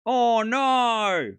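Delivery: long, high, drawn-out "no" wail; mock dismay. Streamer bemoaning a schedule clash, mock dismay.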